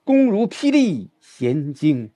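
slow reading, 霹雳 struck hard; slow model with 霹雳 stressed. Shown as a demonstration. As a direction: hit 霹雳 like a thunderclap. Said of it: "这霹雳".

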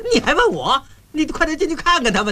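high, agitated urging; exasperated retort. Acted. Groom sending the rival in to see the bride, exasperated. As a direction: throw the question back, then hurry them along.

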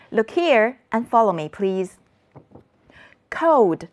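usual speaking voice

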